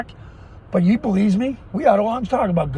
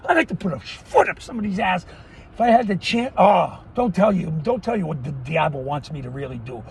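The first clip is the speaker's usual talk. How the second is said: ordinary talk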